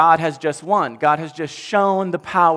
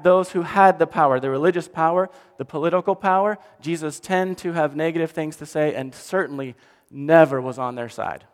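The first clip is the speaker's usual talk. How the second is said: usual speaking voice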